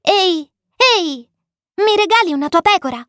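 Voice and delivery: put-on high, quick little child-like voice; vocina insistente. A narrator's reading. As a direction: pipe up insistently as a little prince. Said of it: "fu una vocina sconosciuta che mi chiamava con insistenza". (vocina acuta e insistente)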